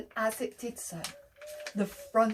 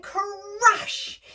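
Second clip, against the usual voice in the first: high, loud crash; shouted crash; 9 semitones higher, 4 dB louder than usual. Shown as a demonstration. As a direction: shout it with the kids on three. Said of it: "then you're gonna shout, "Crash".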